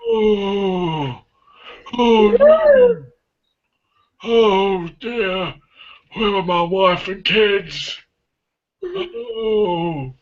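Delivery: high, wailing "oh no" in despair; slow, high sobbing lament for lost family; distressed crying cry. Pretend. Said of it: "I'd go like this".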